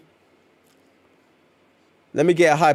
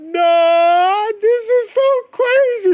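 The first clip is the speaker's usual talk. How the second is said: very high, louder squealing disbelief; hyped squeal; 4 dB louder, slower than usual. Real, not acted. Reacting to the wedding scene, excited high squeal. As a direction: squeal it like you can't believe it.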